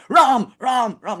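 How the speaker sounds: high, loud excited calling of the name; really excitedly. Retold or imitated. Stranger walking into the temple calls out excitedly. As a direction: burst out in joyful excitement. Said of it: "like, really excitedly".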